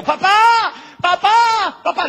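put-on high, loud child's desperate cry. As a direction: call out to papa from the altar, desperate. Isaac calls from the altar.

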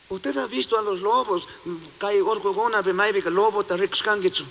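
ordinary talk, nothing marked

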